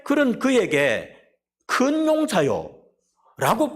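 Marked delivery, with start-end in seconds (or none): 1.7-3.7 very slow, stretched call with a lift on 큰; slow, grand address; drawn-out solemn call. Acted. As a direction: call it out slowly like a proclamation. God calls Gideon again.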